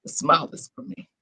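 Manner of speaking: soft, quick whispered aside; a breathy little whisper to yourself. Real, not acted. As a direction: tuck it in as a private murmur.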